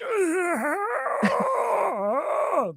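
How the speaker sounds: high strained mock scream; hurt friend screaming in pain, laughing through it; guttural scream. Retelling the stabbed friend's nasty guttural scream. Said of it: "guttural, you know, nasty, you really fucked up kinda scream".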